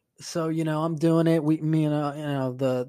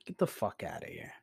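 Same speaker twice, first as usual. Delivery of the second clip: low, soft dismissive scoff; 8 semitones lower, 9 dB quieter than usual. Real, not acted. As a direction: brush the idea off under your breath. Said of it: "scoffing at the idea".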